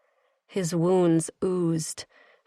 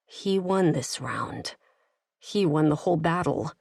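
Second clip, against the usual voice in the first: ordinary delivery, nothing marked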